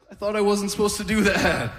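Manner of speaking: high, laughing mock grandpa; wide-eyed old man caught off guard; confused old man. Veteran grandpa puzzled by the colour glasses, high mock voice. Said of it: "He's like".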